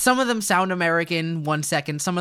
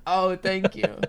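usual speaking voice

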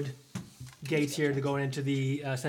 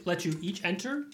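usual speaking voice

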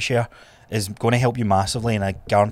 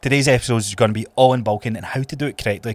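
usual speaking voice

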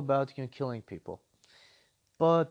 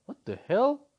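incredulous; 8 semitones higher than usual. Retold or imitated. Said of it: "And I'm like".

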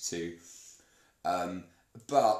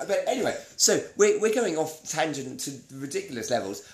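louder, higher, laughing reset; 4 semitones higher, 7 dB louder than usual. Real, not acted. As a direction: pull it back on topic. Loud reset to topic.

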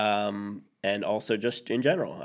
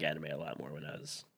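whisper; 7 semitones lower, 10 dB quieter than usual. Real, not acted. Embarrassed admission about anime.

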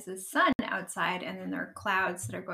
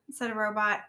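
ordinary delivery, nothing marked

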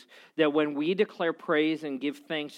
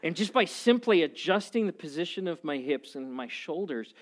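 usual speaking voice

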